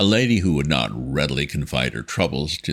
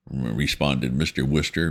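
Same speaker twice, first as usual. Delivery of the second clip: ordinary delivery, nothing marked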